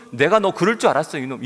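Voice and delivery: loud mock scold; angry scolding. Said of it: "그때 막 분노". (분노하며 크게 꾸짖는 말투)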